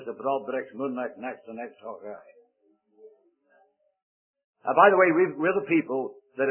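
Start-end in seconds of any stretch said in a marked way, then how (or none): 0.0-2.2 broad Glasgow Scots saying; grandmother's Scottish dialect phrase; Scottish accent. Shown as a demonstration. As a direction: roll out a low Scottish brogue. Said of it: "She taught me how to say".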